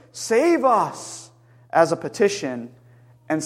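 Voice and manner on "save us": retold shout. Preacher quoting the crowd's petition, mild retold shout. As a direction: call it out as a restrained plea. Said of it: "they were simultaneously shouting".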